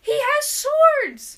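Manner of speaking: high, loud, drawn-out exclamation; excited shout. Real, not acted. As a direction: yell it in sheer delight. Thrilled about finding weapons.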